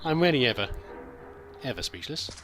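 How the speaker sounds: ordinary delivery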